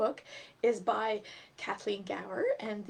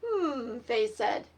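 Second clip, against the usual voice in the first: lively, higher storybook reading voice; reading to children; 6 semitones higher, 9 dB louder than usual. A narrator's reading. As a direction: read it aloud to little kids, bright and animated. Reads picture book to children.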